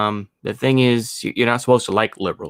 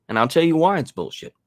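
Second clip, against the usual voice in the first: normal talking voice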